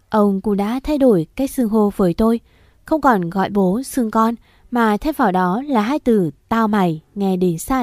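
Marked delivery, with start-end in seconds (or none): none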